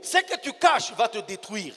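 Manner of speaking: slow sermon warning. Acted. Preacher warns the congregation slowly that hidden things destroy. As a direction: lay each word down like a verdict.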